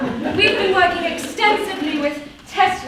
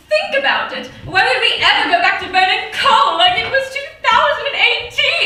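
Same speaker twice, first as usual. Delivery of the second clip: laughing; 6 semitones higher, 5 dB louder than usual. Acted. Mocking the coal era, laughing.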